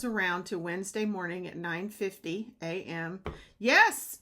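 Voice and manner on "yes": eager yes. Retold or imitated. Reading her eager text reply.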